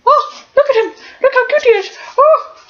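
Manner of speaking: sweet high doggy talk. Real, not acted. Cooing over the dog.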